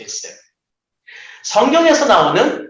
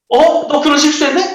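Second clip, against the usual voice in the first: much higher and louder, teasing tone; mocking gotcha; 8 semitones higher, 4 dB louder than usual. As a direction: catch them out with a sing-song jab. Someone points out a mistake.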